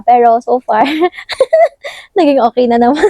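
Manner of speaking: giggling while being tickled; laughing through tickles. Real, not acted. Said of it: "Ah, nakikiliti ako".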